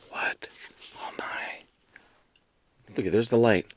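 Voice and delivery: barely audible whisper; soft, breathed disbelief; hushed amazement. Pretend. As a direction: whisper it in wonder. Mock amazement at signed copy.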